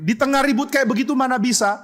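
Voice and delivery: usual speaking voice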